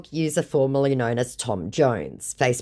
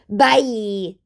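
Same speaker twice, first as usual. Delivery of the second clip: high, drawn-out mocking singsong; mock them with a put-on high voice; hammed-up taunting farewell; 8 semitones higher than usual. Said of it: "came his mocking response".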